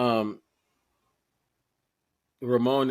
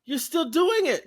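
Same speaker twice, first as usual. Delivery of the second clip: excited greeting; 6 dB louder than usual. Retold or imitated. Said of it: "I was like".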